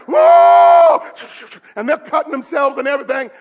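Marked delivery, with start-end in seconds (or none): 0.1-1.0 high, loud sustained yell; hammed-up high howl; mock angry scream. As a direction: scream out like a maddened worshipper. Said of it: "they're getting mad".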